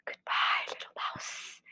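very soft whispered farewell; hissed hush, fading away; scared snake. Acted. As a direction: whisper goodbye as a fleeing snake.

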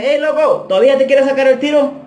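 very high, carrying call; retold shouted question. Asking Guatemala if he still wants the fight, shouting. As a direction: holler a challenge across the block. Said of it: "le grité".

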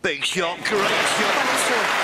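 high, rising excited call. Real, not acted. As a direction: burst out as the shot lands. Shot made, commentator erupts.

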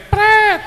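high, loud comic "pret"; imitated "pret" noise. Preacher making a comic noise for the congregation, high loud blurt. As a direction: blurt a drawn-out, high-pitched sound effect.

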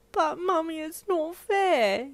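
put-on high, slow child's broken cry; choked attempted yell. Four-year-old trying to yell at her mum, high and choked. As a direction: try to yell but choke up. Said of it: "you tried to yell".

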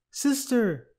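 high-pitched call for sister; alarmed storybook yell; frightened shout. A narrator's reading. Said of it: "shouted Baby".

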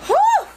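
loud high delighted whew; whoop of delight. Real, not acted. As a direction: let out a high happy whoo. Delighted exclamation.

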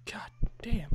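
breathy under-breath whisper; whispered curse. Real, not acted. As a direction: hiss it under your breath.

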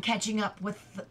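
plain reading voice

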